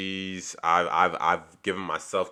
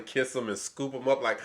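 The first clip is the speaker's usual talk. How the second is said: normal talking voice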